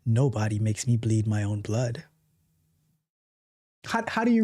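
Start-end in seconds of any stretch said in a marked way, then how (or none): 0.0-2.0 low, menacing villain line; deep, mock-menacing threat; Ben Stiller in Dodgeball. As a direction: growl it like a ridiculous movie villain. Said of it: "like Ben Stiller in a dodgeball".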